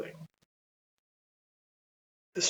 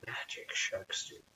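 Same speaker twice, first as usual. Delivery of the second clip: secretive whisper; 9 dB quieter than usual. Acted. Secret about magic tooth.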